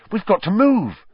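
loud, high, urgent push; sudden urgency. A narrator's reading. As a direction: urge them to go now. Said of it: "said Harry suddenly".

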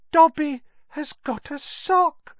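very high, amazed elf; elf in disbelief. A narrator's reading. Dobby holds the sock, high-voiced in disbelief. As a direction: say it as if hardly believing. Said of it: "said Dobby in disbelief".

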